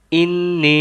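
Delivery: ইন্নী with নি held one alif long; lengthened ni. Shown as a demonstration. Teacher demonstrating the long নি in ইন্নী. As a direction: draw out নি in ইন্নী. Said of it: "নি বলে এক আলিফ টান দিয়ে পড়ব".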